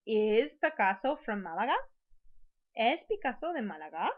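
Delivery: rising at the end on "Málaga"; question intonation. Shown as a demonstration. Teacher shows the class a yes-or-no question, questioning rise. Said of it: "make it sound like a question".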